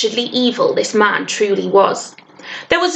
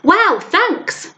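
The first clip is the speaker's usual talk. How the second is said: high, grateful call; 9 semitones higher than usual. A narrator's reading. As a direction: call up your thanks, pitched high. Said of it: "William called up".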